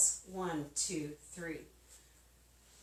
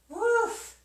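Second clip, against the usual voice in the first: exhausted woof; 13 semitones higher, 7 dB louder than usual. Real, not acted. Exhale after hard set.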